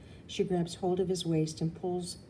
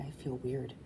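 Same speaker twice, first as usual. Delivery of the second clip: plain reading voice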